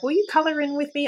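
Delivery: imitating child's request. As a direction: lightly voice a child asking. Voicing her kids' request.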